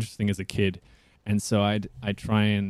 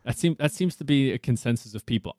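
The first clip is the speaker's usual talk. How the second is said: normal talking voice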